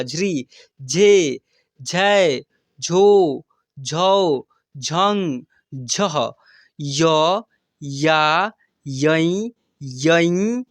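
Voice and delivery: each syllable drawn out and intoned singly; syllable drill recitation. Shown as a demonstration. Teacher reads out barakhadi for practice, drawn-out recitation. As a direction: recite the alphabet slowly, stretching every vowel. Recites the letter series for learners.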